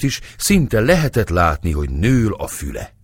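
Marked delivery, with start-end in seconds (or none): none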